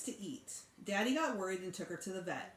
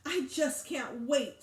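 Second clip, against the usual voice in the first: slow, high and excited; eager; 6 semitones higher, 5 dB louder, much slower than usual. A narrator's reading. Little sister gushing about the puppy, high and eager. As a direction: savor every word of the wish. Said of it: "she said".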